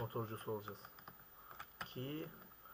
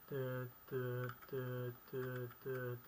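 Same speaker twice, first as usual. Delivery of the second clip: reversing beep imitation; much slower than usual.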